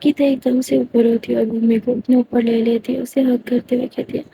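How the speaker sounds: ordinary delivery, nothing marked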